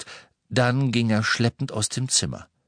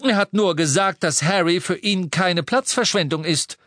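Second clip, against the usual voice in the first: higher, louder indignant retort; 9 semitones higher, 3 dB louder than usual. A narrator's reading. Hestia correcting Petunia, higher indignant voice. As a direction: set the record straight, offended. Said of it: "sagte Hestia entrüstet".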